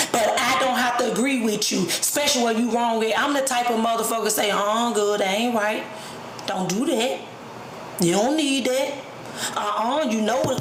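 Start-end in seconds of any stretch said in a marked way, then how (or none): none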